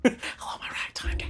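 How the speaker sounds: whispered ditty, all air; breathy whisper. Pretend. Friend joins in the old ditty, whispering. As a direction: answer in a soft breathy whisper. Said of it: "you're just talking breathly".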